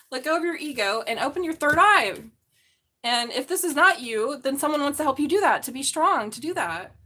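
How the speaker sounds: normal talking voice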